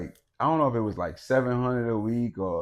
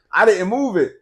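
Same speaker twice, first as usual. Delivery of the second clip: ordinary delivery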